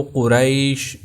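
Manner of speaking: قریش drawn slowly as "qu-RAYSH"; قریش with an "a-i" diphthong, unhurried; soft ya glide. Shown as a demonstration. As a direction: say قریش with a clear gliding "ay". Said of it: "یائے لین کو جو پڑھنے کا طریقہ".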